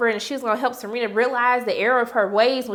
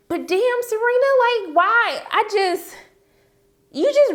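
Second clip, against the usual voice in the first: exasperated disbelief; 10 semitones higher, 3 dB louder, slower than usual. Real, not acted. Exasperated appeal to Serena.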